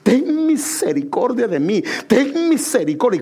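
normal talking voice

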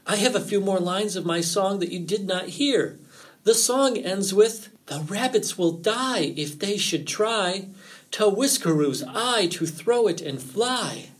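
high, theatrical warning voice; ominous fox. A narrator's reading. Fox recites his warning rhyme.

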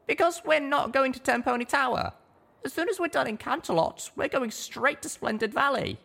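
high, plain little mare voice; a small pony chatting; high little pony voice, chuckling aside; young mare. A narrator's reading.

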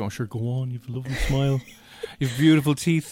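mock charming guard voice; hammed-up flirt with a laugh; flirty guard. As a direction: lay on the cheesy compliment.

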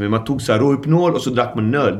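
normal talking voice

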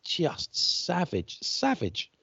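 stretched, high awed savage; awed emphasis. Real, not acted. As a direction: marvel at how brutal it was. Marvelling at brutal hits.

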